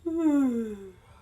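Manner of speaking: long exhaled sigh out loud; audible release sigh. Shown as a demonstration. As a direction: let a big sigh go. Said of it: "And let it go".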